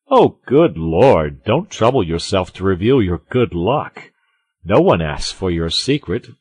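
loud, scolding musketeer; reproving Porthos. A narrator's reading. Porthos warns Aramis about his loose wit, reproving. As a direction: warn a friend forcefully.

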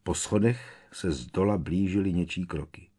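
ordinary delivery, nothing marked

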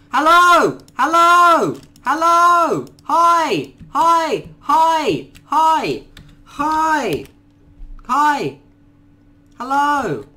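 high, loud nervous greetings; calling out. Real, not acted. Gamer greeting a dangerous creature, loud high calls. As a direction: call out brightly to appease.

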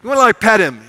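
ordinary talk

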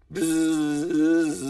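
buzzing bee noise. Acted. Bee flies around the flower, buzzing. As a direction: buzz like a bee circling.

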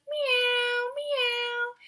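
high cat meowing; high kitty cat meows. Acted. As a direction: meow like a little kitty. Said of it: "The kitty cat says".

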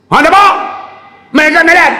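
tengking orang dengan lantang dan tinggi; marah. Retold or imitated. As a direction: lakonan pegawai kaunter yang garang. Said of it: "Cik marah pakcik ni". (tengking dengan suara marah)

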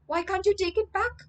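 bewildered. Retold or imitated. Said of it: "Jeffrey is bewildered".

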